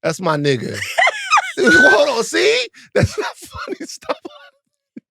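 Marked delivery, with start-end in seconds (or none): 1.2-5.0 high, cracking laughing shout; shrieking laughter. Real, not acted. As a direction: squeal through the laughter.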